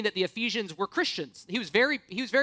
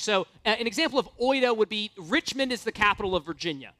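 ordinary delivery

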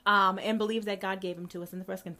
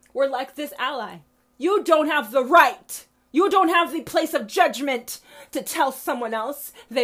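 furious scolding; 4 semitones higher, 9 dB louder than usual. Real, not acted. Railing at judgmental Christians.

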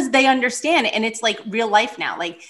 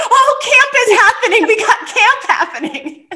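much higher, louder, laughing; giddy relief; 12 semitones higher, 7 dB louder than usual. Retold or imitated. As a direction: ecstatic mother hearing camp is on. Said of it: "I was like".

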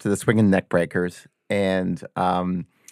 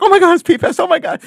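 squealing much higher like a starstruck fan; 5 dB louder, faster than usual. Retold or imitated. Guitarist loses his mind at [name], high squeal. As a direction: shriek it out like meeting your hero. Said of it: "He's like".

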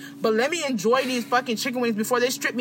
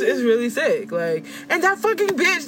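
normal talking voice

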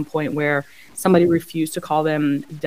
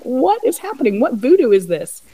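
amazed reaction; 7 semitones higher, 5 dB louder than usual. Retold or imitated. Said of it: "I'm like".

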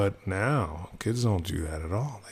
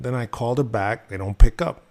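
ordinary talk, nothing marked

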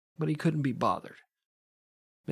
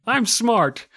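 higher, louder smug voice; smug know-it-all; 7 semitones higher, 6 dB louder, slower than usual. Retold or imitated. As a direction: brag like a proud know-it-all.

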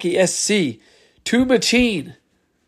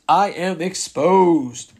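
ordinary talk

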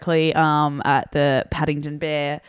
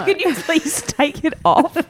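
laughing; 8 semitones higher, slower than usual. Real, not acted. Laughing at cohost's mask.

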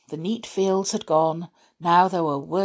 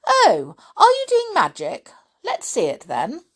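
high, eager know-it-all voice; bossy schoolgirl pouncing on magic; girl eagerly demanding to see the spell; 13 semitones higher, 5 dB louder than usual. A narrator's reading. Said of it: "She had a bossy sort of voice".

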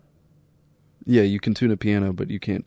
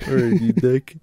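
usual speaking voice

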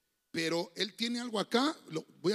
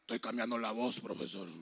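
put-on much lower, croaky teen voice; hoarse breaking voice; 10 semitones lower than usual. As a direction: play a boy whose voice is breaking. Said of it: "andaba ronco". (adolescente con la voz ronca, mucho más grave)

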